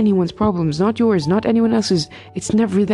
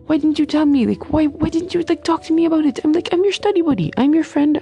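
higher, insistent hurt friend; imitating herself begging her friend to open up; hurt pleading; 8 semitones higher than usual. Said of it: "So I'm like".

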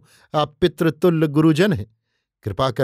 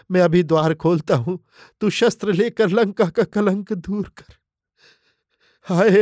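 higher, grieving uncle's cry over his nephew; keen over the fallen son, begging him to rise; grief-stricken uncle weeping over his dead nephew; weeping lament; 4 semitones higher than usual. A narrator's reading. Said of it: "रोते रोते वे कहने लगे".

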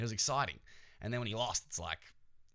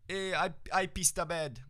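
higher-pitched, hammed-up Jamaican accent; clumsy mock-Jamaican accent, pitched up; bad Jamaican accent; 9 semitones higher, 3 dB louder than usual. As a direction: botch a Jamaican accent imitating a sprinter. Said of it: "racist accent".

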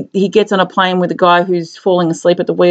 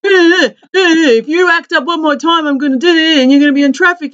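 mock tough guy; 8 semitones higher than usual. Retelling a film scene, a hammed-up tough cop threatening the boss. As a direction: fake a menacing cop who is clearly acting.

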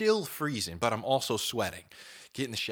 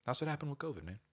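ordinary talk, nothing marked

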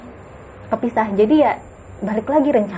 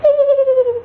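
high eerie ghostly shriek of a laugh, far louder; kuntilanak laugh; 6 dB louder than usual. Retold or imitated. Telling a friend about the ghost, shrill eerie ghost laugh. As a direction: cackle like a female ghost in the trees. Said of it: "ada suara kuntilanak".

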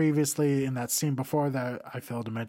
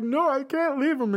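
mock tearful heroine; 10 semitones higher than usual. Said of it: "Michelle Rodriguez is like".

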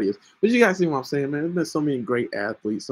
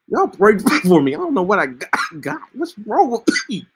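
high-pitched voice; 6 semitones higher, 5 dB louder than usual. Real, not acted. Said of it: "talk in a high-pitched voice".

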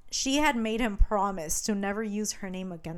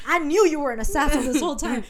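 fast, louder mock outburst; retold screaming; 4 semitones higher, 5 dB louder, faster than usual. Betrayed man accuses the assassin who lied, mild mock scream. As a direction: imitate an accusing yell, pitched up.

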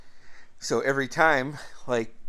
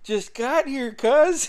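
much higher, casual but buoyant; cheerful reply; 13 semitones higher, 6 dB louder, slower than usual. Retold or imitated. Cousin John answering brother at the party, higher cheerful reply. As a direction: toss it back happy to be there.

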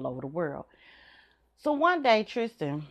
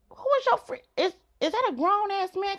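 suspicious mom grilling. Retold or imitated. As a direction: interrogate the son. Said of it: "retold challenge to son".

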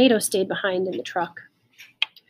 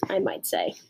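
soft, dry whisper; whispered aside; 5 dB quieter than usual. A narrator's reading. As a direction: drop to a wry whisper.

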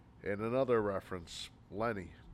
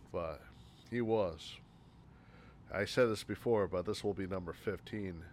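usual speaking voice

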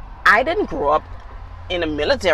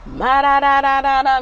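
loud, high, held babble of nonsense syllables; mock noise-making; 8 semitones higher, 6 dB louder than usual. Mom imitating her son's racket on his mic, loud mock noise. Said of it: "just making noise".

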